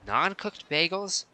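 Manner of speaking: high, slow incredulous rise on bagels; baffled question. Real, not acted. Streamer reacting to a chat question, slow baffled rise. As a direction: ask in disbelief, drawing it out. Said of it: "What are you talking about?".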